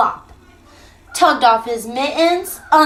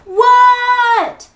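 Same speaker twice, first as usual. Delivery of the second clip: high, loud yell; 10 semitones higher, 4 dB louder than usual. Acted. Froggy yells back loudly to his mother. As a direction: shout it back. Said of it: "yelled Froggy".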